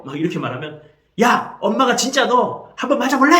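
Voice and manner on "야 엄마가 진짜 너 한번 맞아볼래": acted mother exploding, high loud shout; angry outburst. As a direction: blow up at the child, pitched way up. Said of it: "하면서 막 화를 내는 것입니다". (폭발해서 소리치는 엄마 흉내)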